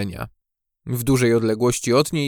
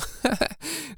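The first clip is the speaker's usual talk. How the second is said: high, light giggle; laughing; 8 dB quieter than usual. A narrator's reading. Maja giggles at her monster joke, light laugh. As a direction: giggle at your own joke. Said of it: "śmiała się".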